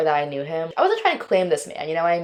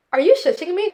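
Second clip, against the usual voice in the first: indignant disbelief; 12 semitones higher than usual. Retold or imitated. Indignant at being shushed.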